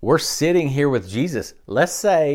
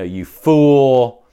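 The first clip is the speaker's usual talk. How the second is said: mock insult; 10 dB louder than usual. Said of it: "He said".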